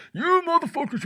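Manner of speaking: very high, very loud mock scream; screamed insult. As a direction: scream it over loudspeakers. Steven yelling over speakers.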